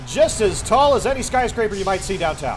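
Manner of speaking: announcer voice. Acted. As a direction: call it like a stadium battle commentator. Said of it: "this announcer voice".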